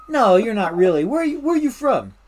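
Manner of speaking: loud imitated New York accent. Local guy doubting the newcomer, in a New York accent. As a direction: push back in a put-on New York accent. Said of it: "talk with their New York accent".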